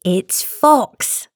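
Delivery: high little mouse voice; lightly acted high-pitched mouse; storybook mouse. A narrator's reading. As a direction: pipe it up like a mouse. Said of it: "said the mouse".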